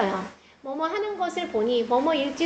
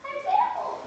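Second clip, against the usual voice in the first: much higher, insistent yell; losing patience with a small child; mom's frustrated shout; retold exasperated yell. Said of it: "얘기했죠?".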